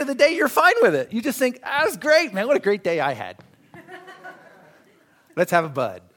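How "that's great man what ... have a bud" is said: hammed-up self-satisfied buddy; mock easygoing guy. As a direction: kick back after the day. Mocking content sinner.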